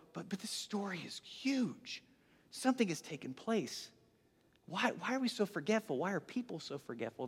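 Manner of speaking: very soft, near-whispered plea; hushed urgency. Acted. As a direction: plead under your breath, puzzled by the forgetting. Urgent musing on forgotten story.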